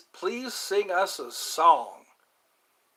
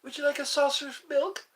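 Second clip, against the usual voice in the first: higher, fast kitten voice; 8 semitones higher, faster than usual. Pretend. Asking about milk in a higher kitten voice. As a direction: offer the milk sweetly as a kitten. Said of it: "Try to sound like a kitten".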